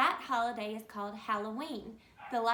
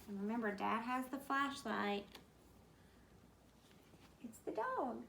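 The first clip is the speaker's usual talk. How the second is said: plain reading voice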